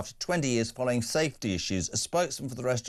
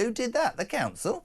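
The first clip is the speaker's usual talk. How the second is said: mock outrage; 11 semitones higher than usual. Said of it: "he knew I'd say".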